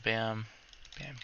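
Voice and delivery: normal talking voice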